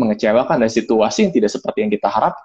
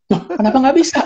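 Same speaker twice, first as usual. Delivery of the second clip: high-pitched protesting question; taken aback; 11 semitones higher than usual. Retold or imitated. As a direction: imitate a baffled customer objecting. Acted quarrel with the bike mechanic. (protes kaget)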